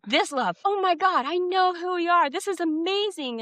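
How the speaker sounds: bursting high with joy, oh my God; ecstatic recognition. Retold or imitated. Body voiced meeting love in meditation, overjoyed high exclaim. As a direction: gasp into sudden delighted love. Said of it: "the body's just like".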